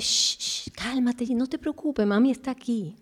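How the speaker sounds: hushed shh, then soft comforting words; mother soothing her crying child, softly. Retold or imitated. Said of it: "tú vienes y le dices".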